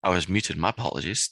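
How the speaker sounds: normal talking voice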